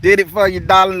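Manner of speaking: a proud, happy shout to someone he did it for; excited shout-out. Real, not acted. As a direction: call it out proudly to her. Shouting love to Sasha.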